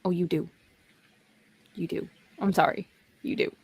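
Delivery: normal talking voice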